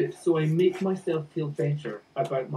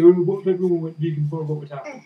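mock skeptic; 6 dB louder, much faster than usual. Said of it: "even if people say".

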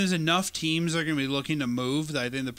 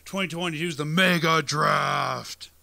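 booming slow announcer shout; monster truck ad; 3 dB louder, slower than usual. Pretend. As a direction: hype it like a monster truck rally ad. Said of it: "We need the guy who does the monster truck voice".

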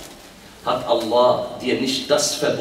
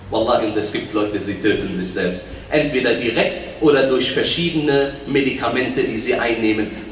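ordinary talk, nothing marked

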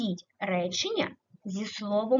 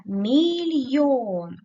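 "мільйон" stretched slowly, louder and higher on йон; drawn-out stressed word; 4 semitones higher, 6 dB louder, much slower than usual. Shown as a demonstration. Teacher asking pupils where the stress falls, slow stressed demo. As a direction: draw out "мільйон" so the stress stands out. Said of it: "Куди падає наголос?".

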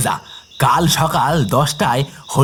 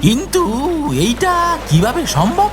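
high, incredulous questioning; astonished disbelief; 9 semitones higher than usual. Acted. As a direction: let the pitch soar in amazement. Acted disbelief at restored river. (অবাক বিস্ময়ে চড়া গলায়)

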